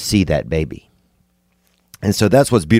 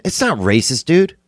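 ordinary talk

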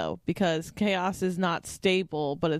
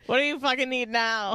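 put-on annoyed boyfriend; 6 semitones higher, 4 dB louder than usual. Mocking annoyed partner voice.

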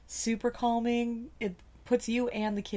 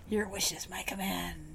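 whispered, breathy genie; 2 semitones lower, 5 dB quieter than usual. Acted. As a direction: hiss it like a creepy genie. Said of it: "that voice is coming up a lot".

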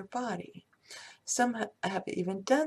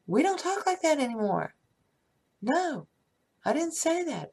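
raised, louder voice scolding the monkey mind; firm self-talk; 7 semitones higher, 3 dB louder than usual. Shown as a demonstration. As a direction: shush the inner critic firmly, pitched up. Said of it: "you say".

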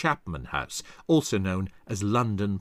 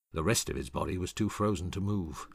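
usual speaking voice

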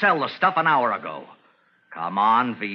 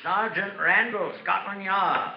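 put-on English police accent, polite and proper; a crook faking an English accent to pass as a police sergeant; fake English accent; 4 semitones higher, 4 dB quieter than usual. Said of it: "What became of your English accent?".

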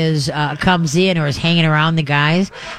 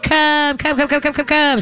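much higher, bright, singsong come; happy dog call; 10 semitones higher than usual. Shown as a demonstration. Host showing listeners how to call a dog cheerfully. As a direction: sound like fun to the dog. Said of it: "And so what you do is you squeak".

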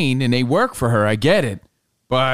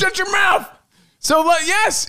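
high yelling protest; shouting. Real, not acted. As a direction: yell over a friend. Friend steals his story.

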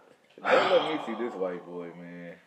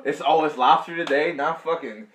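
mock rich parent; 6 semitones higher, 12 dB louder than usual. Voicing the rich dad's household.

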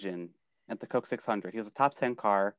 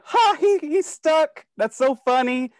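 high, loud mock jeering laugh voice; mocking Squidward-style gloat, pitched way up; 13 semitones higher, 6 dB louder, slower than usual. Fan mimics Squidward laughing at a stuck car, mock laughing. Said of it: "of, like, Squidward laughing, going".

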